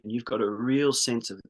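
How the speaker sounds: normal talking voice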